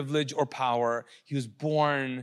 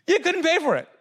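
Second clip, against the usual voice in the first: excited; 13 semitones higher, 4 dB louder than usual. Acted. Mocking impulse purchase.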